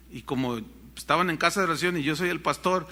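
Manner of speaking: ordinary talk, nothing marked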